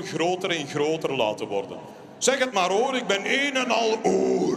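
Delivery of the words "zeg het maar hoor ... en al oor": hammed-up all ears; eager listener. Giant ear listening eagerly.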